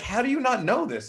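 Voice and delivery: high-pitched mock disbelief; incredulous. Greens challenging the careless blues, incredulous. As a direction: act out an appalled stickler, voice jumping up.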